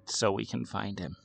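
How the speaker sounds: soft, dry little croak. A narrator's reading. Girl finally answering her brother, dry croak. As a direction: croak it quietly from an unused voice. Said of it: "croaked Amy in a dry voice".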